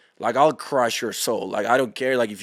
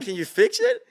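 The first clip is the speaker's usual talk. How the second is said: put-on much higher, a whiny kid begging; squeaky plea. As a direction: squeak it like a pestering child. Begging doctor again.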